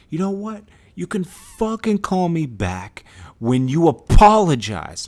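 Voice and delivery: loud, high, slow angry ultimatum; spazzing out. Retold or imitated. Video maker demands apology before hanging up, angry yelling. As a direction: hit each word hard, fed up. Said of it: "I spazzed 'cause he was being so disrespectful".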